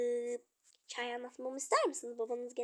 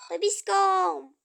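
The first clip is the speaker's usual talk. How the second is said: excited greeting; 3 semitones higher, 9 dB louder, much slower than usual. Acted. Child calling out happily to father. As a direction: stretch it out with joy.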